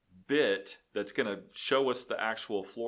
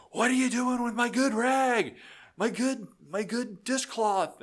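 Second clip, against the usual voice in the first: much higher, louder mock scolding; imitating the angry wife; 13 semitones higher, 4 dB louder than usual. Mocking the wife scolding him over her rag, much higher. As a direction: shriek it like the outraged housewife. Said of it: "she's like".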